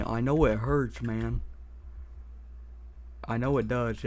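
ordinary delivery

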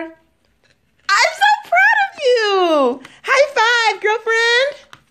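very high, laughing, tearful praise; overjoyed. Real, not acted. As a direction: gush with pride. Mother overjoyed at child's success.

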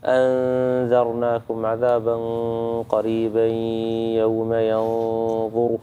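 أنذرناكم عذابا قريبا recited slowly with the hidden noon held; slow recitation, nasal hold on each ikhfa; ikhfa demonstrated. Shown as a demonstration. Teacher reciting an example of ikhfa for the student, slow and measured. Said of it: "نفس الشيء عند الإخفات".

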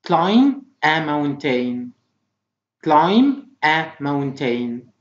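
very slow, separated words; slow model reading. Shown as a demonstration. Teacher reading vocabulary for pupils to repeat, slowly. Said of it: "Try to repeat after me".

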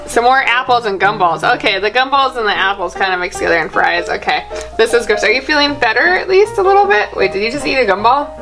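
ordinary delivery

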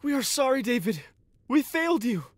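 high, pleading apology; crying out. Acted. Servants apologize to David, high and pleading. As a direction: cry out your shame to your king. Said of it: "they cried".

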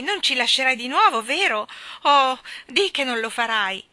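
loud, high, frightened plea; crying out. A narrator's reading. Jane begging Mary Poppins not to leave, loud cry. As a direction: beg her loudly not to go. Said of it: "gridò Jane".

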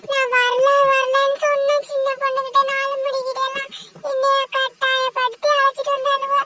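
whiny scared woman. Acted. Lakshmi whines to the others that she is scared, high and tearful. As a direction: complain like a frightened grown woman about to cry.

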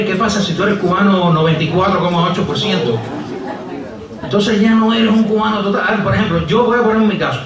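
ordinary talk

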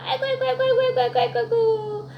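high wailing aigo of a defeated devil; 사탄의 울부짖음. Acted. 사탄이 또 지는 장면. (패배한 사탄처럼 높게 우는 아이고)

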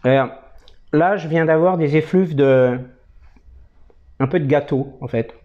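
talking around pipe stem; words past clenched pipe; pipe in mouth. Real, not acted. Seen 2: Pipe stem held between lips while speaking; removed by 6.4.

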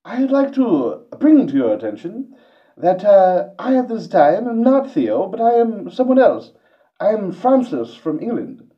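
put-on very high, loud posh English accent like Stewie; Englishman. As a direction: greet them in a shrill cartoon English accent. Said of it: "let me try my Englishman".

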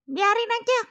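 high cat voice, dismissive; uncaring cat. Pretend. Cat dismisses the crying baby, high cat voice.

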